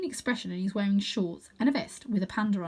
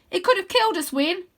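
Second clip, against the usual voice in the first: put-on higher, louder cross girl voice; scolding girl; 10 semitones higher, 6 dB louder than usual. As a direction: tell him off sharply.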